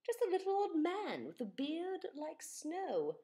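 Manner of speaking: ordinary delivery, nothing marked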